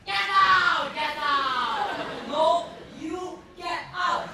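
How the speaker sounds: roaring order to leave; furious shouting. Acted. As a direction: scream get out at full force.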